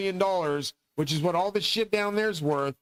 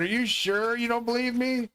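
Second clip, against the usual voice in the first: teasing; 7 semitones higher than usual. Retold or imitated. Teasing the doubting doctor.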